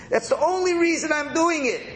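earnest farmer. Acted. Farmer voiced in rabbi's example.

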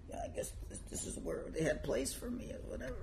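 sheepish whisper. Acted. Embarrassed rabbi answers from the floor.

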